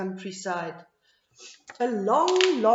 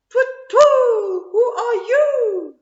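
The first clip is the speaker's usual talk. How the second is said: high, loud hooting owl voice; 12 semitones higher, 13 dB louder, slower than usual. A narrator's reading. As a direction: hoot it out like an owl. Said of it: "hooted the owl".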